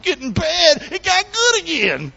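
high, stretched, delighted holler; gleeful high-pitched preacher's whoop; excited exclamation. Real, not acted. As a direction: let the pitch soar on the good news. Exclaiming over the story.

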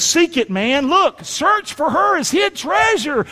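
high preaching shout, slowing at the end; urgent exhortation. Real, not acted. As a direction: shout it urgently, stretching the key words. Urging congregation to search Scripture.

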